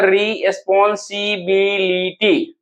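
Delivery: "रिस्पॉन्सिबिलिटी" slowly, syllable by syllable; broken into syllables. Shown as a demonstration. Teacher breaking the option up, "रिस्पॉन्सिबिलिटी" slow and segmented. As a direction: separate every piece of "रिस्पॉन्सिबिलिटी". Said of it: "इसको जब तोड़ के लिखेगा".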